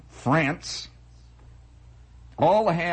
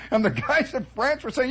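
ordinary talk

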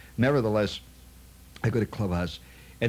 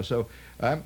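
normal talking voice

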